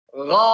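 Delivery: "Gha" held long with a rounded "o" colour; thick ghain. Shown as a demonstration. Teacher showing which part of the tongue rises, thick ghain. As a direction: raise the back of the tongue for a heavy "Gha". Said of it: "yang naik pangkal lidah".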